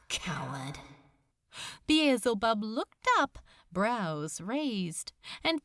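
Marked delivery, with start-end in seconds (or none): 0.1-0.8 soft, lower mutter to himself; bitter muttered insult; muttered self-reproach. A narrator's reading. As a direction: spit it quietly at yourself.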